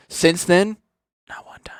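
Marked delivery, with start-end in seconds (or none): 1.3-1.8 whispered under the breath; hushed bitter whisper. Real, not acted. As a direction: drop to a whisper for the punchline. Bitter aside about her mom.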